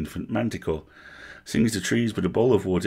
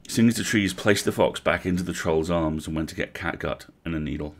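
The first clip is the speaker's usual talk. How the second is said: plain reading voice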